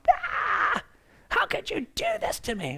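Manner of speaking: high panicked mock wail; freaking out. Believer panicking at God: mock anguished wail. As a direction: cry it out like a hurt, panicking believer. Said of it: "And we are freaking out".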